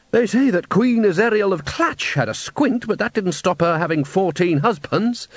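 high, dry, exasperated manager; lofty disbelief; Salzella voice. A narrator's reading. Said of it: "said Salzella".

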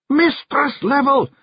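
very high, stretched-out Scottish yell; Rob Anybody yelling. A narrator's reading. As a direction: holler slowly to rouse her. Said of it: "Rob Anybody yelled".

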